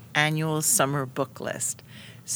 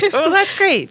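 high, loud laughing reply; 13 semitones higher, 9 dB louder than usual. Real, not acted. Host laughing at the heated book fights, high and loud. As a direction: laugh the words out.

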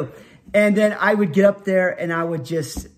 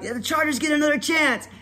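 high-pitched play-by-play call; announcer; 9 semitones higher than usual. Retold or imitated. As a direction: announce a reprieve for the home team. Announcer voice after the penalty.

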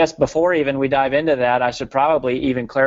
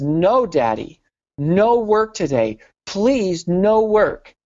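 put-on higher, slow, a toddler begging and building; pleading toddler; 6 semitones higher, much slower than usual. Little girl begging her daddy not to go to work, pleading higher.

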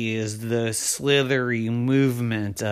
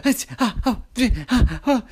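gasping, high stammer of fright; huffing and puffing; 10 semitones higher, much faster than usual. Acted. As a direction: pant instead of finishing words. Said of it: "huffing and puffing and breathing funny".

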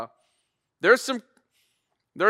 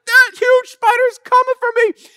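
put-on terrified kid; 9 dB louder than usual. Scared son on the counterweight, shrieking about spiders. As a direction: wail it like a kid covered in spiders. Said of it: "and Max is like".

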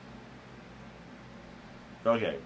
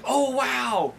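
high, shouted fake excitement; mock excited; 13 semitones higher, slower than usual. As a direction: gush like a starstruck fan. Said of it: "it's like".

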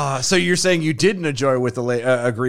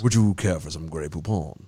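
put-on low, very proper southern English accent; proper British; 8 semitones lower, 6 dB quieter than usual. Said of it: "Southerners who are very proper".

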